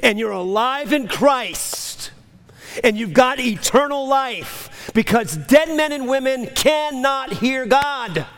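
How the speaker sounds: fervent blessing building to proclamation; impassioned preaching. Real, not acted. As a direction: preach it out, stretching the key words. Preaches at full force.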